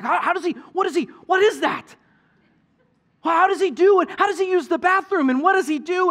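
put-on high, loud volley of kid questions; high-pitched, worked-up impression of curious children; imitating kids' questioning. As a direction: mimic excited kids firing off questions. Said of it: "they're asking a thousand questions".

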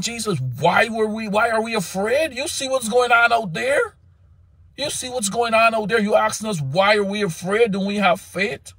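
disciples questioning. Acted. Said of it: "And they saying".

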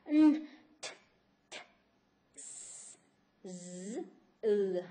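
isolated consonant sounds one by one; teacher modelling single sounds; sound demo. Shown as a demonstration. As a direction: voice each consonant alone, s and z breathy. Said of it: "estos son los sonidos".

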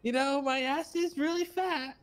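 high, drawn-out female impression; Hazel impression. Fooling a friend who thought you was Hazel, high put-on voice. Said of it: "she asked me to do the voice".

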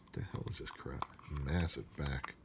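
ordinary talk

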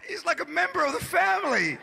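high-pitched tearful protest, hammed up; mock crying. Upset woman protesting the price at the vet, mock crying. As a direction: wail it in mock distress. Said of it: "She was crying".

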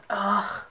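throaty groan of exasperation; exasperated ugh. Real, not acted. Brit hearing lift called elevator, exasperated ugh. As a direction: let out a disgusted ugh. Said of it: "gonna be doing ugh more often".

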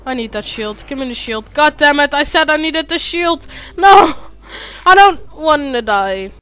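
ordinary talk

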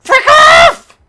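very high, loud shriek; real burst of frustration; angry yell. Real, not acted. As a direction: scream it at the enemy. Yelling at enemy in game.

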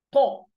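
"طا" loud with a heavy, full ط; emphatic ta. Shown as a demonstration. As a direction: fill the mouth with air and push out "طا". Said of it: "أملى بقي هوا".

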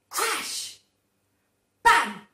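sudden loud bang words; loud crash. A narrator's reading. Reading to children, loud sudden crash. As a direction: burst out on the noise.

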